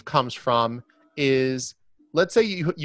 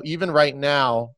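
normal talking voice

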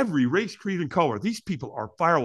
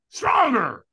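high, loud trainer's yell; screamed command; 8 semitones higher, 5 dB louder than usual. Retold or imitated. Trainer screams at holdback firewalker, loud urging. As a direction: demand more at full push. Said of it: "he screams at me".

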